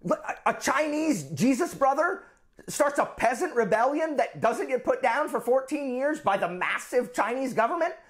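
high-pitched, incredulous outburst; astounded. Real, not acted. History teacher reeling at thirty million dead, incredulous. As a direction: marvel in disbelief, pitch riding high.

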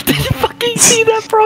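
high, slowed shocked cry; scared horror game streamer. Real, not acted. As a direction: still reeling from the scare. Jump scare reaction.

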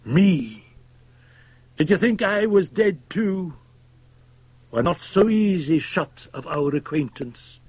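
high, ringing, accusing voice; defiant Fettes. A narrator's reading. Fettes confronting his old acquaintance, bitterly defiant. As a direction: throw it at him, pitched high.

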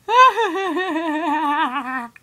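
long, loud run of laughter; laughing. Real, not acted. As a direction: burst out in a drawn-out laugh. Laughing at the game.

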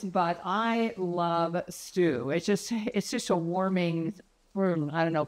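ordinary talk

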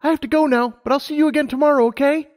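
put-on high, eager young mare; Lumina's voice. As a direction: voice her up high, keen and bright-eyed. Lumina says goodbye.

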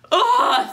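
high, loud groan of disgust; exasperated groan. Acted. Boy groans in embarrassment.

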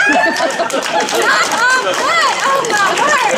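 slow, high, laughing through the words; laughing exclamation. Real, not acted. Comedian laughing after her joke, high and amused. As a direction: giggle out the words.

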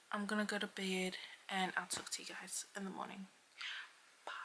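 soft whispered goodnight; whispering. Real, not acted. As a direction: whisper the sign-off quietly. Signing off at night.

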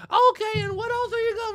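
put-on very high, loud kid voice; mock eager kids. Kids quiz a celebrity in the elevator, mock eager. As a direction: imitate excited children pressing questions. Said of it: "the whole family is engaged".

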